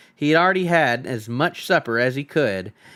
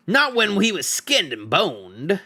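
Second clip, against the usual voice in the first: slow, higher troll voice; grim troll; 6 semitones higher, slower than usual. Acted. Troll muses about skinning the hobbit, slow and grim. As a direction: relish the grim idea slowly.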